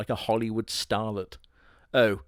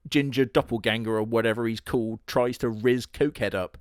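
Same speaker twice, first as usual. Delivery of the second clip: normal talking voice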